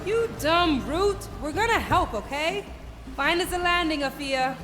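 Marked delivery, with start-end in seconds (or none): 0.1-1.3 dopey cartoon bellow. Insulting the stubborn teacher.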